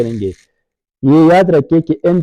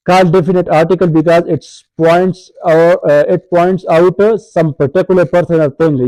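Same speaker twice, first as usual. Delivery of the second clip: reads the English definition slowly and carefully; slow textbook reading; 3 semitones higher, much slower than usual. Shown as a demonstration. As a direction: dictate the definition from the book.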